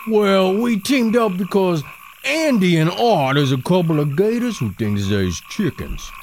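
lightly hammed Cajun swamp hunter; proud Cajun hunter voice; Cajun accent. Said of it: "Cajun fellas".

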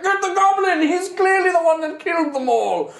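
high, loud shouted accusation; guards raising alarm. Acted. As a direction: yell like guards giving chase. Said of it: "the guards are all like".

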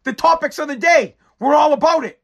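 high, bright announcer opener; announcer kicking off the show; show intro voice. Acted. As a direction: sell the show open like a host.

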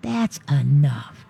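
firm correction. Shown as a demonstration. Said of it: "screaming and that".

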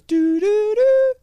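high sung beeps of a disconnected line; phone error tones. Retold or imitated. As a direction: imitate the three rising disconnect tones. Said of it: "the number goes".

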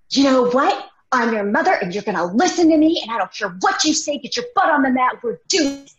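loud, high drill-sergeant yell; angry mom snapping. Retold or imitated. Mother snapping at her kids over yoga, angry yell. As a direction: bark it like a fed-up drill sergeant. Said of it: "finally snapped".

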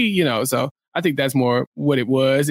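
ordinary talk, nothing marked

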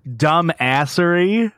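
slow, rising disbelieving echo; drawn out in mock disbelief; incredulous. Real, not acted. Repeating his own answer in disbelief, drawn out and rising.

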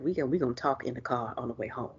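low, soft, pointed warning; understated mock threat; quiet stern warning. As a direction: mutter it like a parent in public. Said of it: "woulda been like".

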